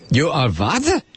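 incredulous mock German interrogator; movie German accent. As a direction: react in disbelief, film German accent. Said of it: "would consider a German accent".

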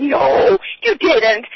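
loud, high cashier's disbelief; retold astonishment. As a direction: exclaim it like an amazed cashier. Retold amazed reaction of cashier.

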